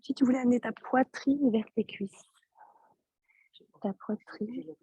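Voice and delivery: quiet, fading teacher voice drops well below normal level; too soft for the class to hear; speaking too softly. Real, not acted. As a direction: sore throat, barely audible instruction. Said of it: "J'entends rien".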